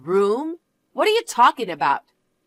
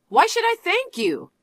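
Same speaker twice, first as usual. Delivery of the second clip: much higher, outraged question; indignant disbelief; 12 semitones higher than usual. Acted. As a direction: shoot up in outrage. Indignant mother-in-law argument.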